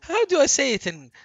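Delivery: high, loud words through laughter; laughing. Real, not acted. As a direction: squeeze the question out while cracking up. Talking through his laughter.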